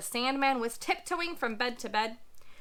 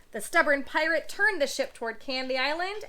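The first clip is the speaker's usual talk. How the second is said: ordinary delivery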